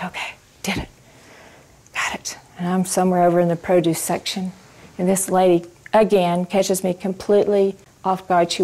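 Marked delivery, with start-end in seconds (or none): 0.0-2.4 a private sigh of relief, whispered to yourself. Retold or imitated. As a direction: breathe it out under your breath, unvoiced. Relief after the event.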